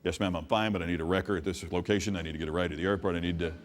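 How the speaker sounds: reciting on autopilot, still in shock; robotic autopilot. Retold or imitated. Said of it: "I'm still on instinct".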